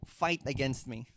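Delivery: ordinary talk, nothing marked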